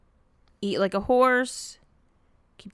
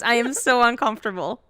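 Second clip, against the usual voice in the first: normal talking voice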